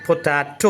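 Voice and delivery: പൊട്ടാറ്റോ as a crisp po-TAA-to; sharp, crystal-clear articulation. Shown as a demonstration. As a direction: show the child a sharp, clear പൊട്ടാറ്റോ with a distinct final "to". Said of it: "sharp clear crystal". (പൊട്ടാറ്റോ കൃത്യമായി, സ്ഫുടമായി "പൊ-ട്ടാ-റ്റോ" എന്ന്)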